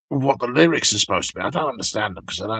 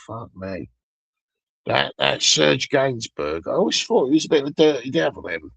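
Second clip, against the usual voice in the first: normal talking voice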